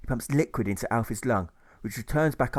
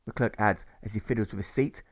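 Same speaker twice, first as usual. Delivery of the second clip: plain reading voice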